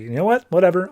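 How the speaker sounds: mock a villain losing patience; mildly pissed off. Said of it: "getting pissed off".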